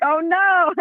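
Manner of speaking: high, mock dismay. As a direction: play up the panic. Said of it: "I'm like".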